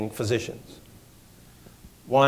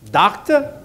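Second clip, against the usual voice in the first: a sharp, much higher "Doctor" in a South Boston Irish brogue; 10 semitones higher, 3 dB louder than usual. Retold or imitated. The district attorney calls the doctor, South Boston Irish brogue. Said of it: "South Boston Irish brogue".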